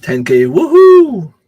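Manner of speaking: high, loud whoop of excitement; drawn-out celebratory woohoo; celebratory cheer. Real, not acted. Celebrating the subscriber milestone.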